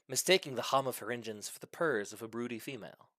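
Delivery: ordinary delivery, nothing marked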